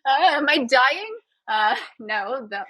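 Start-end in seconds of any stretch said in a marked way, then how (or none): 0.0-1.2 high, comically alarmed confusion; hammed-up alarm with a laugh; mock bewildered panic. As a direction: act baffled and worried by your own tears. Mocking own bewilderment at tears.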